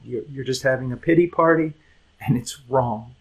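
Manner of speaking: ordinary talk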